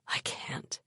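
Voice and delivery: breathless whispered refusal; whispering. Acted. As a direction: whisper it, defeated. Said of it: "he whispered".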